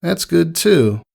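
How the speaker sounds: high pony voice, trailing off; awkward Rainbow Dash. A narrator's reading. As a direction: at a loss for words.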